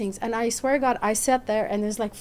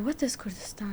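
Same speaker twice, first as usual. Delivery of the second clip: puzzled whisper; 9 dB quieter than usual. Retold or imitated. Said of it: "and they say".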